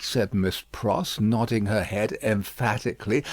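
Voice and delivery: ordinary delivery, nothing marked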